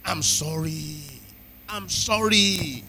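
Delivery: slow, drawn-out "sorry"; acted apology, stretching each word out; model apology. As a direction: plead it slowly, lingering on sorry. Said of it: "is to come and say".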